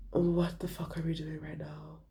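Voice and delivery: hissed whisper aside; a sharp whispered aside to friends. Retold or imitated. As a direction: whisper it so the man can't hear. Aside to friends about the man.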